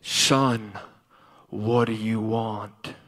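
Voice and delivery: very low, slow, solemn God voice; voice from the throne. Retold or imitated. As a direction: intone it deep and drawn out. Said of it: "And God said".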